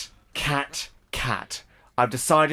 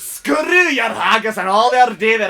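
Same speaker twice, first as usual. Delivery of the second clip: high, loud Scottish outburst; angry Scottish shout; 8 semitones higher, 7 dB louder than usual. Acted. Vegetarian Scot shouts back over haggis, angry Scottish accent.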